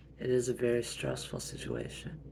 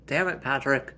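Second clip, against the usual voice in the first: annoyed outburst; 2 semitones higher, 10 dB louder than usual. Acted. Annoyed at Patrick.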